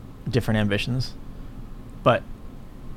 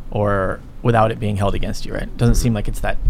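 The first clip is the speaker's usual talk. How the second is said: usual speaking voice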